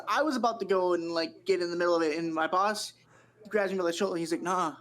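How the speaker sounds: ordinary delivery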